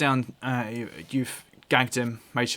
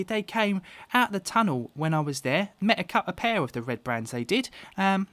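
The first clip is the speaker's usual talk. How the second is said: put-on high-pitched boy telling his adventure; boastful little lad; Carp, young boy; 6 semitones higher than usual. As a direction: blurt it out in an eager child's voice. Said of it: "And Carp pipes up".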